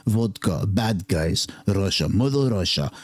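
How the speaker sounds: low, hammed-up Russian accent; horrible Russian accent. Host mocking movie bad guys, bad Russian accent. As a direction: do a low, badly faked Russian villain accent. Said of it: "That was a horrible accent".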